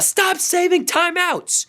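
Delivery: high, slow shout at the coach; exasperated scolding. Real, not acted. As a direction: scold him like it's obvious. Fan berating coach.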